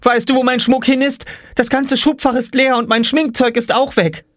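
put-on very high upset girl voice; upset Jule. A narrator's reading. Jule complaining to Mama, upset. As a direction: complain urgently.

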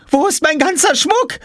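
put-on very high shriek of a panicked girl; shrill-high girlish scream. A narrator's reading. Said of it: "Jule kreischte".

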